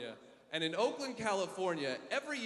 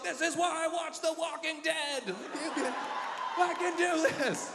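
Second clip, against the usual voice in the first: psyched-up shout; 9 semitones higher than usual. Retold or imitated. Psyching up against zombies.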